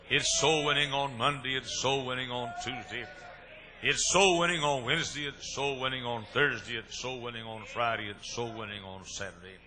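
rising, loud list, then easing off by Saturday; preacher rolling through the week of soul winning, building then fading; building preacher cadence. Acted. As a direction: pound out each day, climbing then settling.

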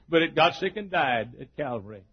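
usual speaking voice

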